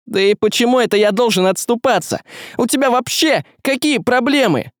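indignant, high-pitched retort; defiant comeback. Acted. Василий talks back to the shadow.

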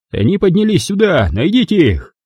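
high, urgent order from behind the door; searcher's order. A narrator's reading. Soldier shouting beyond door. (приказ из-за двери)

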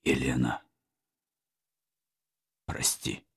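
soft whisper; whispered farewell. A narrator's reading. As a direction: breathe the goodbye softly. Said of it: "сказал он".

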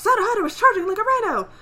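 loud, high commentary; race announcer. Acted. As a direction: call the charge for the crowd. Acting the announcer's race call.

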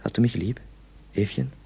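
low, soft lover's murmur; hushed, affectionate question; tender teasing. Acted. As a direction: ask gently, close to your sweetheart.

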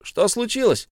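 calling out. A narrator's reading. Bilbo calls out to Gollum in the dark. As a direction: call out into the dark. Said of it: "окликнул его Бильбо".

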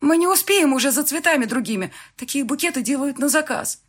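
high, urgent objection; flustered protest. A narrator's reading. Bride telling groom there is no time, flustered. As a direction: object in alarm, pitched well up. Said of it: "растерянно сминая в руках".

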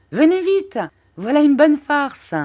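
high, loud gleeful call; shouting. A narrator's reading. Traddles calls the returning pupils over, shouting high and loud. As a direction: yell it out to everyone, delighted. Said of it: "il leur criait".

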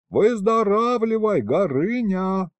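high, drawn-out farewell call. A narrator's reading. Hero shouting goodbye on the run, calling out. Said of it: "крикнул я уже на ходу".

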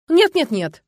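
high, loud string of refusals; flustered alarm. A narrator's reading. Youth refuses to leave the castle, alarmed. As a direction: panic and fend it off. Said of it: "переполошился парень".

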